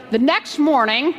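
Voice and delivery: ordinary delivery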